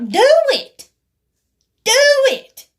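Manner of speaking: high, loud goading yell; shouted dare. Retold or imitated. Daring her to call as she just stands there, shouting.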